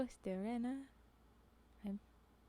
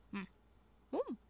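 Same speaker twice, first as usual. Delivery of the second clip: ordinary delivery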